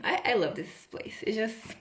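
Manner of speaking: normal talking voice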